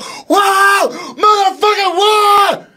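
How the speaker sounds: very high, drawn-out whoop of relief; euphoric shout. Retold or imitated. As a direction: let out a wild high holler, acting out the escape. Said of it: "That was the feeling".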